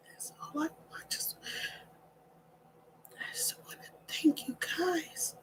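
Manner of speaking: very soft, voice nearly gone with emotion; choked-up whisper. Real, not acted. Speaker thanking subscribers while overcome, choked-up whisper. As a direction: struggle to get thanks out, barely audible.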